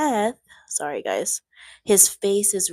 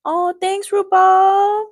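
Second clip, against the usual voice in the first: high, loud sing-song thanks; cheery high-pitched thank you; squeaky thanks; 10 semitones higher, 9 dB louder than usual. Real, not acted. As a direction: gush at the viewer up high.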